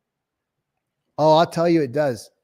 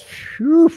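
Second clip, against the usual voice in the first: high, loud exaggerated phew; mock whistle phew; 12 semitones higher, 6 dB louder than usual. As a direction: blow out in mock shock. Reacting to a big number.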